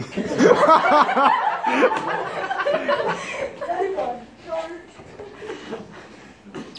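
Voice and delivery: bursts out in a loud high laugh. Real, not acted. Crowd laughs at robbery punchline.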